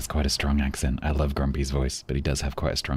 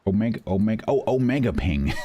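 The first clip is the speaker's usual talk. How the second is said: dawning realisation; 4 semitones higher than usual. Real, not acted. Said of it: "working out the word".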